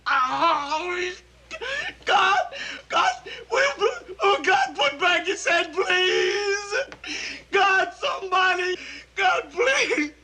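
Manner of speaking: crying, pleading shouts; sobbing panic. Acted. Airman sobbing to God over his headless buddy, crying shouts. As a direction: beg through tears in horror.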